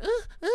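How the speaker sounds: short, high-pitched imitation moans; mimicked moaning.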